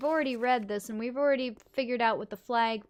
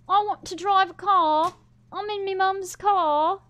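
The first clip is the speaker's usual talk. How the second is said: put-on whiny child plea; 8 semitones higher, 9 dB louder than usual. Childish drive-the-car plea.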